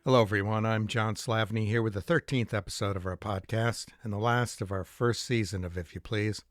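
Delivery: usual speaking voice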